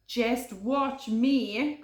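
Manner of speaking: slow, bold; confident. Acted. Hare boasting to Podgy, slow confident. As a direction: boast with swagger. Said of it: "cried Hare".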